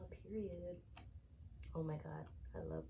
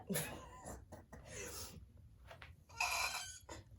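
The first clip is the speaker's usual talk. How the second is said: a high, breathy shriek of a laugh; 5 dB quieter than usual. Real, not acted. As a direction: let out a wild fangirl shriek. Screams over the photocard.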